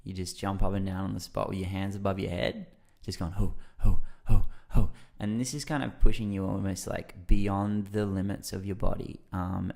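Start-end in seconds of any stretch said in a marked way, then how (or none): 3.3-4.9 loud, punched rhythmic hoots; mantra chant. Shown as a demonstration. Showing the jumping mantra to a listener, loud chant. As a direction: chant it in short forceful bursts. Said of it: "the mantra is just who".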